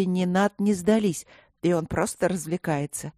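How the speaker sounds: ordinary delivery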